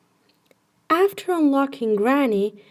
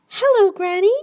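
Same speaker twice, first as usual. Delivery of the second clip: put-on higher, louder cheerful child's hello; 10 semitones higher, 5 dB louder than usual. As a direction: greet granny brightly.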